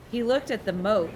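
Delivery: usual speaking voice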